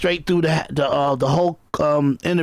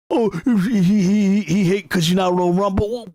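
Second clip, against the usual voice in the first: hammed-up imitation of the rival's accent; lightly voiced sneering impression; mocking Fatty's accent. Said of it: "and it's like this".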